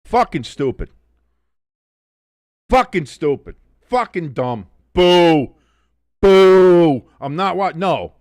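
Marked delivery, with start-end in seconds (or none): 4.9-7.1 long loud drawn-out boo. Real, not acted. Fan booing the halftime announcement, loud boo. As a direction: boo it like a stadium crowd.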